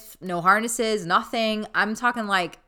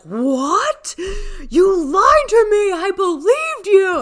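high, loud, slow crying protest; imitating Nick's tearful yell; mock teary outcry; 13 semitones higher, 9 dB louder, much slower than usual. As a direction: wail it like a friend caught off guard, half sobbing. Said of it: "And Nick was like".

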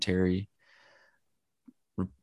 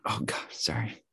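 strained whisper after choking; gasping apology mid-choke; choking on a drink; 4 semitones lower, 11 dB quieter than usual. Real, not acted. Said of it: "Choking on something".

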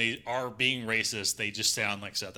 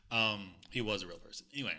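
ordinary talk

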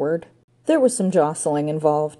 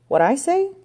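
high puzzled echo; incredulous echo; 9 semitones higher than usual. A narrator's reading. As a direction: parrot it back, baffled. Ranger echoes again.

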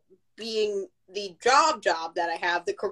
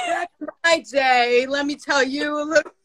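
higher, louder grumpy elder; old-timer voice; 6 semitones higher, 3 dB louder than usual. Retold or imitated. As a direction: tell it like a nostalgic old-timer. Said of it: "You'll be like".

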